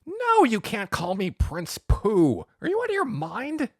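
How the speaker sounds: scandalized, voice leaping up; outraged refusal. A narrator's reading. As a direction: refuse in high-pitched, incredulous outrage. Enraged Pu Yi rejects the nickname.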